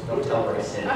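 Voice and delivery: usual speaking voice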